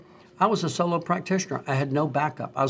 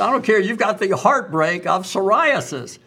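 retold doctor's insistence; 7 semitones higher, 5 dB louder than usual. Dermatologist quoted insisting on diagnosis.

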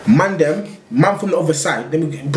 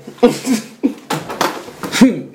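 airy unvoiced laughter; breathy laugh; 7 semitones higher than usual. Real, not acted. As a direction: let a hushed wheeze of laughter out. Laughing at the punchline.

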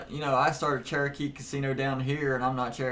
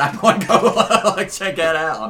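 laughing; 6 semitones higher, 11 dB louder than usual. Real, not acted. Laughing about the waving hand.

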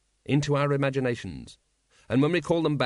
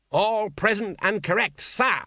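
higher, parade-ground bark; barked report; 8 semitones higher, slower than usual. Acted. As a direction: snap out the report like a drill sergeant. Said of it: "he barked".

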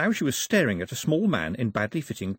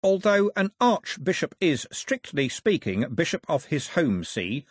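slow, higher, pondering voice; deliberate, pedantic musing; thoughtful; 7 semitones higher, slower than usual. A narrator's reading. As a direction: muse slowly over a fine point. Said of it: "said Mister Red thoughtfully".

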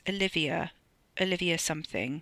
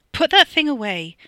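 loud, high, urgent order; raised-voice demand; alarmed command; 8 semitones higher, 11 dB louder than usual. A narrator's reading. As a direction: order it sharply, rattled. Nervous demand to holster pistol.

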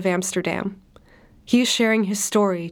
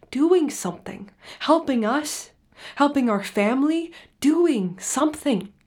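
higher pitch, swooping on each phrase; impassioned insistence; 5 semitones higher than usual. Acted. Refugee telling therapist how he helped family, impassioned. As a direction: pile up each phrase with conviction.

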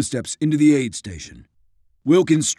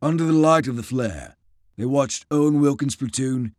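plain reading voice

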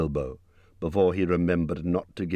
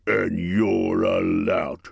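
troll quarrel; 3 dB louder, much slower than usual. A narrator's reading. William fires back.

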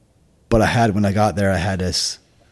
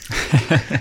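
short laugh; 6 semitones higher than usual. Real, not acted. Laughs after the sergeant story.

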